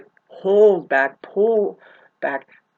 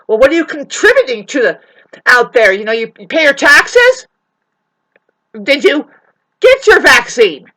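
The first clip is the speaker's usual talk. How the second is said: mocking narcissist; 4 semitones higher, 10 dB louder than usual.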